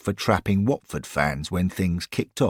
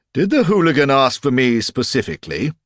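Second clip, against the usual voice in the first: put-on old man voice; 7 semitones higher, 5 dB louder, faster than usual. Old club founder asks.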